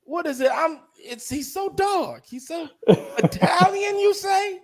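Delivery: high-pitched mock puzzlement; put-on character voice. Friend puzzling over an Italian's dark look, put-on high voice. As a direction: draw it out in a high, disbelieving put-on voice. Acted bit continues, mock disbelief.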